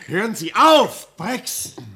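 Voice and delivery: loud, high and slow, commanding. Acted. Doctor ordering the raving signalman to stop, loud. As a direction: order him to stop, forcefully.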